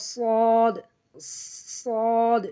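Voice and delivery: সদ with hissed s and long rounded vowel; drawn-out sod. Shown as a demonstration. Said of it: "দুই দাঁতের পেট ও আগার সঙ্গে". (নিচের দাঁতে জিভ লাগিয়ে টেনে সদ)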